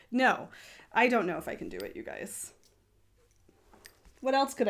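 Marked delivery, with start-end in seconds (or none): none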